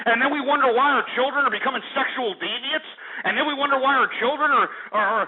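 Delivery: panting with outrage at the callers; heated rant. Real, not acted. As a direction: keep the fury rolling. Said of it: "I know that I'm panting".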